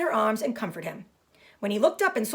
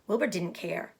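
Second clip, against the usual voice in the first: ordinary delivery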